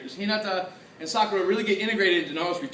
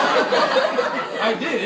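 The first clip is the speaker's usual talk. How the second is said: laughing; 8 semitones higher, 5 dB louder than usual. Real, not acted. Still laughing.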